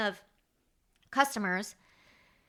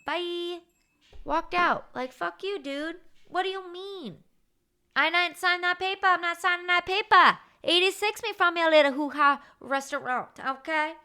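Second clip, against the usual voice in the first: much higher and louder, furious; retold server yelling back at the owner, much higher and louder; retold angry yell; 9 semitones higher, 6 dB louder than usual. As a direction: yell it back at the boss and storm off. Retold shouting match with owner.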